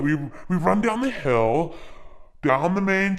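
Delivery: ordinary talk, nothing marked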